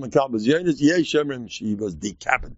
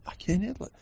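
soft, high mock helplessness; softly whiny mock complaint; overwhelmed complaint; 8 semitones higher, 9 dB quieter, faster than usual. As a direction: imitate someone who can't cope, higher and softer. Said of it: "we talk like that".

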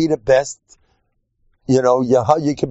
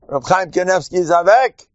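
usual speaking voice

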